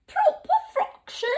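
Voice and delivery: very high, prim posh British voice; hammed-up upper-class British accent. Shown as a demonstration. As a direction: say it like a prim British aristocrat, pinkies up. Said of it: "So pinkies up when you say that".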